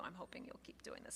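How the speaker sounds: softly whispered hope, almost unvoiced; whispered aside. Real, not acted. Teacher whispers her hope to the class. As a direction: breathe it as a private aside.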